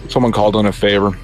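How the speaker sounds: normal talking voice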